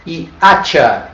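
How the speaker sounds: saying hacha slowly with a silent h; Spanish word, slow. Shown as a demonstration. Teacher models the Spanish word slowly: hacha with silent h. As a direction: hacha said as atcha, slow and clear. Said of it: "que quer dizer machado".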